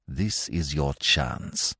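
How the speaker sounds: "This is your chance" slow, low and weighty; emphatic. A narrator's reading. Investigator warning the warder, "This is your chance" slow and emphatic. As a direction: spell out "This is your chance" slowly, softly. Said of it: "he said emphatically".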